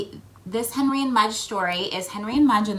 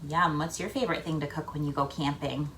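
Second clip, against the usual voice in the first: usual speaking voice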